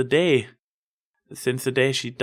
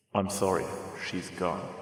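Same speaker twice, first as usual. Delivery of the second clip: somber doctor; 4 semitones lower, 8 dB quieter than usual. Acted. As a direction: lower and much softer, hushed. Doctor announces death.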